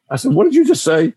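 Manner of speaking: imitating his own shocked anger at the kid; indignant outburst. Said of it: "I got really".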